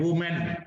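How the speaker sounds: "वुमेन" as wu-men, falling; singular pronunciation. Shown as a demonstration. Teacher models the singular word, singular pronunciation. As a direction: say "वुमेन" with a rounded "wu" first syllable. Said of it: "इसको बोलते हैं वुमेन".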